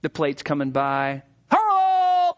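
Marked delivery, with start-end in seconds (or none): 1.5-2.3 mock call out. Calling out as plate passes.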